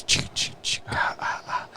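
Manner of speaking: whispered wheezy chee chee ah. Retold or imitated. High Ghostface on the phone, whispered wheeze. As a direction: breathe it out in a stoned whisper. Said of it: "And he goes".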